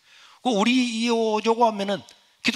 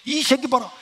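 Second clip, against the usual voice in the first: angry teacher; 7 semitones higher, 4 dB louder than usual. Retold or imitated. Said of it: "선생님이 열받아가".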